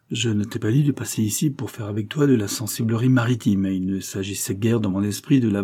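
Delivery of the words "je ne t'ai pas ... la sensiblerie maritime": ordinary delivery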